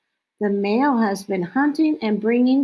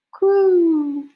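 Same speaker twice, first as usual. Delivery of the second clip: high, drawn-out coo; owl mating coo; 8 semitones higher, 4 dB louder than usual. Retold or imitated. Reading about the male barn owl's mating call, high coo. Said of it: "The male's mating call is coo".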